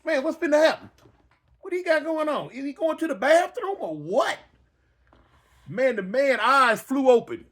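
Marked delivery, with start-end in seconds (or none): 0.0-4.4 a touch higher, uneasy questions; half-scared muttering to yourself; nervous worry. Retold or imitated. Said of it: "I'm like".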